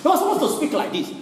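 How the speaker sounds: fast, laughing smug complaint; mock critic. Preacher mocks critics of the pastor, fast and smug. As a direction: rattle it off like a know-it-all. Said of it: "But they will say".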